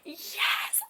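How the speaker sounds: breathy whispered yes; a secret little yes. Retold or imitated. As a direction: hiss it under your breath in triumph. Whispered private celebration.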